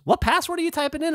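mock high-pitched Emily; Emily's voice. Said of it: "Emily looks at it and she goes".

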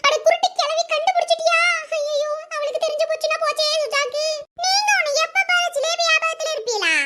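high-pitched flustered complaint; exasperated grumble. Acted. Caught out, grumbles at old woman. (பதற்றமான உயர்ந்த குரல் முணுமுணுப்பு)

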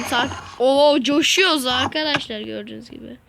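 ordinary talk, nothing marked

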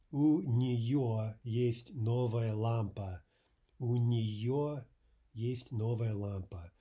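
drawn-out, projected teaching delivery; careful half-speed language demonstration; slow model sentence. Shown as a demonstration. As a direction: model it word by word for learners.

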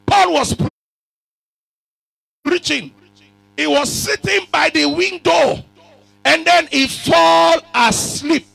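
high, loud, slow preaching; preaching shout. Acted. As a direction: shout the story slowly.

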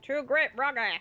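lightly put-on American Southern accent; mixed mock accent. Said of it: "what accent I was trying to do there".

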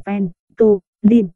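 "ven, tô, lin" as three separate syllables; syllable-by-syllable reading. Shown as a demonstration. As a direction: sound out "ven, tô, lin" with pauses. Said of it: "ventolin đọc là". (đọc "ven, tô, lin" tách từng âm tiết)

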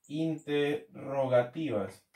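half-speed, broken into syllables; slow dictation. Real, not acted. Teacher repeating a term as it is written, slow dictation. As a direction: say it slowly as if writing it out.

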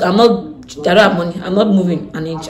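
normal talking voice

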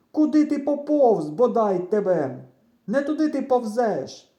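high, loud scolding across a distance; irritated shouting. A narrator's reading. Boy scolding his friend for crawling astray, irritated shouting. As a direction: yell at them, exasperated. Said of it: "роздратовано кричу я".

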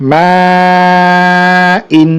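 sustained level "মা", then quick "ইন"; four-alif elongation. Shown as a demonstration. Teacher repeating four-alif madd, first vowel held very long. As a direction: hold "মা" very long before a short "ইন". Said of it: "চার আলিফ টানার".

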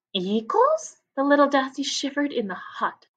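put-on lively read-aloud for children; story narration. A narrator's reading. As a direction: tell it to a class of young kids. Reads story aloud to class.

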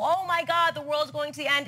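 high-pitched hammed-up alarm; exaggerated doomsday panic; mock panic. Mocking alarm over ozone hole.

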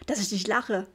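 ordinary delivery, nothing marked

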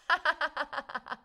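high burst of laughter; laughing. Real, not acted. Streamer finds her helper just left, laughing.

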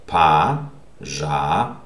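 "Пожалуйста" broken into slow separate syllables, first vowel as A. Shown as a demonstration. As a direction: sound out "Пожалуйста" with pauses, starting "pa". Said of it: "So this will be pronounced A".

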